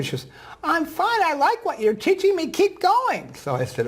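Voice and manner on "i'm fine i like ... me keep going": put-on high, slow frail old lady; old woman voice. Guest imitates the elderly reading student, high and slow. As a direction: voice the ninety-four-year-old gently. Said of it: "I'm gonna use a voice that s-, sounds like her".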